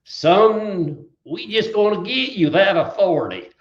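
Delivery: high, chuckling Southern drawl; laughing Southern lawyer's drawl. Retold or imitated. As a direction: put an arm round the boy and reassure him, Southern style. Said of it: "in this southern drawl".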